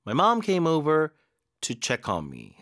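very slow, split into two chunks; slow model reading. Shown as a demonstration. Teacher reading a sentence in chunks, slowly. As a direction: break it into two slow phrases. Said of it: "어디에 힘을 줘야 되는지".

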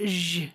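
ዥ said as a sustained zh; French-style zh. Shown as a demonstration. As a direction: draw out ዥ like a French "je" sound. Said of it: "프랑스어 발음처럼".

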